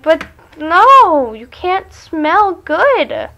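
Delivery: high, loud, drawn-out complaint; mock dismayed protest. Protesting that an ugly guy smells good, mock dismay. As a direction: wail it slowly in mock dismay.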